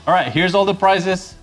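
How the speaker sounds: usual speaking voice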